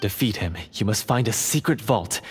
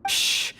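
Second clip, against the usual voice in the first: shush. Acted. Guard hushing the prisoners.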